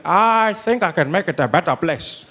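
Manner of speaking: slow, stately statesman; Nelson Mandela. Acted. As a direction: make it a measured, noble promise. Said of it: "I love the Nelson Mandela voice".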